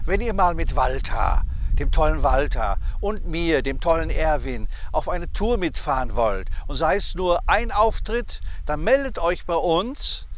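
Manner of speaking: upbeat loud promo pitch over music; promo announcement. Acted. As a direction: sell the contest like a radio ad. Announces prize contest with music.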